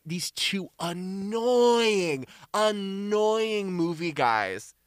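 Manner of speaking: very slow, stretching out "annoying"; drawn-out teasing. Pretend. Teasing about the two movie guys, long drawn-out words. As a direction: drag the words out for comic annoyance. Jokingly drags out the insult.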